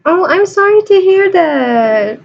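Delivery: high, slow commiseration; drawn-out, high-pitched "aww" tone; exaggerated sympathy. Real, not acted. Host sympathizes with downcast guest.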